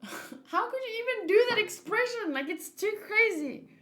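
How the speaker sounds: high, loud laughing disbelief. Real, not acted. Laughing at a wild face on screen, high and loud. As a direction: laugh it out, can't believe the face.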